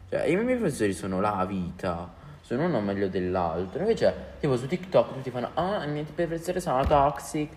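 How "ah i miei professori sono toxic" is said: mocking. Mocking TikTok users.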